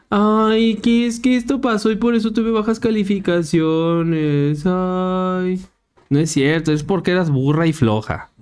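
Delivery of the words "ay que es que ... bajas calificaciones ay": voz aguda de quejica burlón; excusa quejumbrosa. Retold or imitated. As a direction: imita una excusa lloriqueando. Burla de excusa llorona. (voz chillona de burla)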